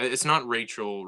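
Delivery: normal talking voice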